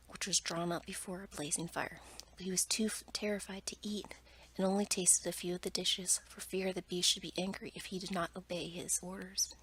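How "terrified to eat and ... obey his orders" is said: ordinary delivery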